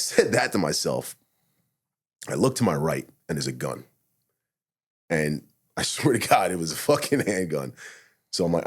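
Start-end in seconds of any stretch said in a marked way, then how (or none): none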